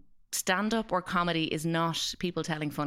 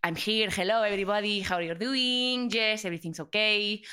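put-on Spanish accent Enrique; 4 semitones higher than usual. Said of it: "Enrique would burst in the door".

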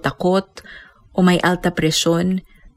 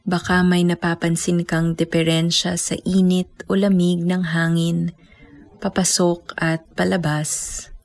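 guided meditation. Acted. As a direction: guide them gently into stillness. Leads breath meditation.

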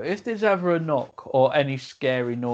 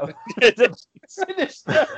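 slow, laughing through the words; can't stop laughing; 13 semitones higher, much slower than usual. Real, not acted. Laughing at the story.

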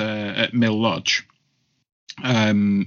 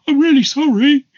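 very high, loud frightened apology; squeaky apology; 8 dB louder than usual. Retold or imitated. Driver apologising to son, high squeaky apology. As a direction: squeal it like a terrified grown man. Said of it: "he just went".

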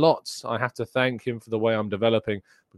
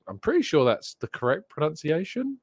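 ordinary talk

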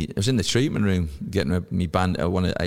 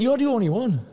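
mocking teammate; 3 dB louder than usual. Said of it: "And he went".

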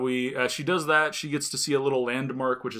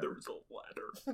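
whispering; 11 semitones higher, 17 dB quieter than usual. Pretend. Whispered echo of ladder.

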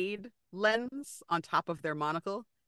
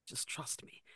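hushed urgent whisper; 8 semitones lower, 17 dB quieter than usual. Acted. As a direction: whisper it close and tense. Hushed plea to player.

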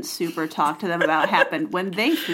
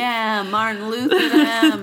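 laughing; 3 semitones higher, 5 dB louder, slower than usual. Real, not acted. Joking about nailing letter.

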